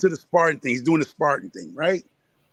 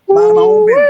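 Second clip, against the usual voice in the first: high, loud yelled repeat of the phrase; sudden shout; 14 dB louder, faster than usual. Acted. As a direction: belt it out high to make the point. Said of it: "Ooh-wee".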